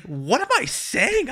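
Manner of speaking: high, slow disbelieving; husband catching himself, high and slow; incredulous self-talk. Retold or imitated. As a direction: stop yourself in mock disbelief. Catching her own silly thought.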